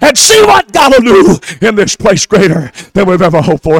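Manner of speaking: shouted, high sermon climax; full-force preaching. Acted. Preacher shouting blessings over the congregation at full force. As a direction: preach it hard and high to the crowd.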